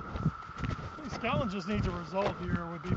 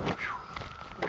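breathless whew. Real, not acted. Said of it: "That's a little out of breath".

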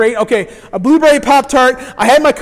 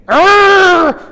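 loud high mock Hulk roar; hammed-up Incredible Hulk roar; 9 semitones higher, 8 dB louder than usual. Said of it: "turned from Bruce Banner to Incredible Hulk".

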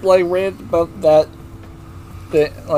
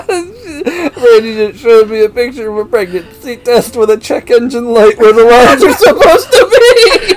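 laughing while talking; 7 semitones higher, 5 dB louder than usual. Real, not acted. Laughing while telling the picture.

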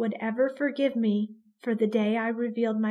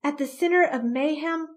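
ordinary delivery, nothing marked

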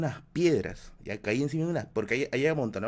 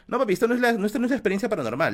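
excited correction; 9 semitones higher, 5 dB louder, faster than usual. Real, not acted. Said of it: "excited correction to chat".

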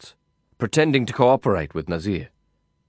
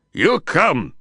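much higher, louder, gruff foreign command; Nazir repeating; 8 semitones higher, 4 dB louder than usual. A narrator's reading. Nazir repeating his demand to the defiant narrator, angrier. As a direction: hammer the order home, angry. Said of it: "he repeated".

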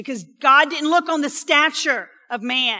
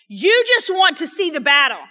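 angry brother; 4 semitones higher, 7 dB louder than usual. Acted. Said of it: "Eliab being angry".

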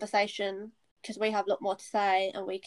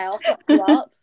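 loud joking threat; mock threat; 3 semitones higher, 12 dB louder than usual. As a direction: yell a playful threat. Playful threat to friend.

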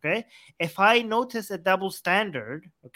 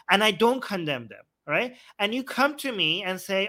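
ordinary talk

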